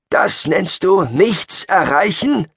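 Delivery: slow, high-pitched incredulous question; zealous retort. Acted. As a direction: draw it out like an affronted preacher. Zealot answers the desperate warning. (langsame, hohe, salbungsvolle Gegenfrage)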